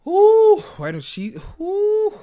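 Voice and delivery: high, loud squealing oohs; shocked ooh. Real, not acted. Shocked by Luann's words.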